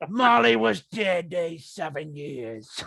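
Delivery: slow, drawn-out mock Cockney reading; lightly put-on Cockney accent, slowed down. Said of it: "read it in a Cockney accent".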